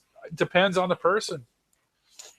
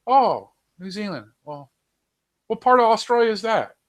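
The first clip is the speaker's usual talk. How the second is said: higher, louder mock-ignorant question; imitating clueless questioner; 6 semitones higher, 6 dB louder than usual. Locals quizzing a New Zealander, imitated as clueless. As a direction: put on an oblivious, overeager local.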